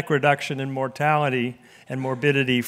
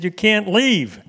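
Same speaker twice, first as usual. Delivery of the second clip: urgent call; 6 semitones higher, 4 dB louder, slower than usual. Retold or imitated. Lone man stopping speaker leaving.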